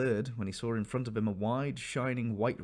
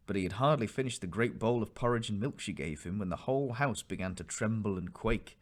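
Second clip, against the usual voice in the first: usual speaking voice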